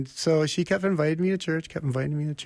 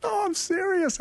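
earnest insistence. Retold or imitated. Insisting to doubtful wife.

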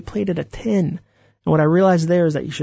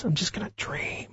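a dreamy, breathless whisper; 2 semitones higher, 11 dB quieter than usual. Retold or imitated. Quoting people who ignore their loans, whispered. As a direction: hush it like a secret wish.

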